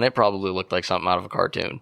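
ordinary talk

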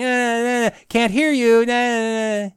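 sing-song can't-hear-you taunt, fingers in the ears; a lightly hammed-up playground jeer; childish taunt. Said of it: "plugged their ears saying".

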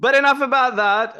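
high, loud mock-dramatic pivot. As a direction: announce the change like a show host. Host mock-grand transition.

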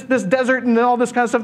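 ordinary delivery

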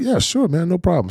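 friendly no problem; easygoing agreement. Retold or imitated. Emmett agreeing to greet the uncle, high breezy voice.

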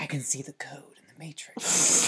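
mock-mysterious whisper. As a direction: breathe it like a hacker secret. Joking Matrix line.

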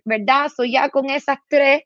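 usual speaking voice

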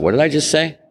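startled high question to himself; sudden high self-surprise; surprised at himself. Retold or imitated. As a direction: catch yourself, amazed at what you just said. Shocked at his own offer.

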